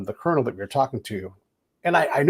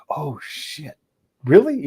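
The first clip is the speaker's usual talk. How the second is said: retold surprise; 4 semitones higher, 3 dB louder, slower than usual. Telling a podcast host how he reacted to the interpreter's insight, surprised.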